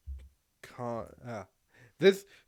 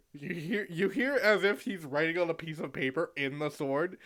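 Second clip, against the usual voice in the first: normal talking voice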